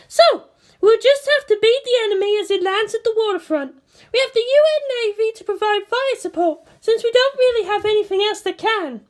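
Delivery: high woman's voice, briefing; voiced commanding officer. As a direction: report damage like an operations chief. Misato briefing.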